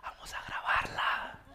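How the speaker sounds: slow, sneaky whisper; conspiratorial whisper. Acted. Son to dad, plotting to film mom, whispered. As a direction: whisper it like a secret plot.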